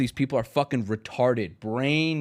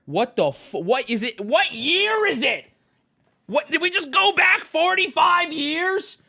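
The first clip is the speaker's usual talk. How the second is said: incredulous; 13 semitones higher than usual. Real, not acted. Incredulous rant about the year.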